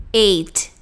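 "Eight" closed by a crisp t; eight with final t. Shown as a demonstration. Teacher repeating the number for learners, crisp t. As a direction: finish "Eight" on t, no hushing sound. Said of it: "E o número oito".

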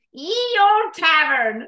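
slow, drawn-out mock-olde proclamation; put-on ye-olde voice, stretched out; grandiose name. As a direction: announce the tavern with hammed-up grandeur. Naming tavern in grand put-on voice.

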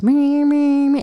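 high-pitched "meh" vehicle noise; imitated little-car siren. Patient moved in a little car, imitated high siren. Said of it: "one of those little cars".

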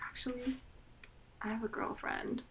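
very soft, whispery imitation of his reply; mock-confidential half whisper; hushed retelling. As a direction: drop to a near whisper, quoting him. Imitating a character's quiet reply.

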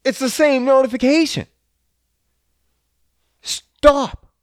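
sudden loud, high yell at the computer; real shout of irritation; real annoyed outburst. Real, not acted. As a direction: snap at a pinging notification, fed up. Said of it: "Getting worked up over here".

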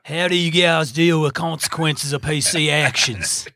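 put-on, overdone Australian English; amateur Australian accent impression; bad Australian accent. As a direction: read it in a clumsy mock-Australian accent. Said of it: "Do a bad Australian accent".

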